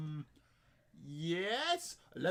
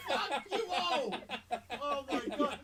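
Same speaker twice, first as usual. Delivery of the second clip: laughing; 6 semitones higher, slower than usual. Real, not acted. Laughing at his own attempt.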